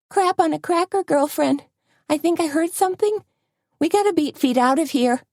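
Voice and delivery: high, hurried, frightened; spooked, wanting to flee now; scared and urgent. A narrator's reading. Tony frightened, urging escape.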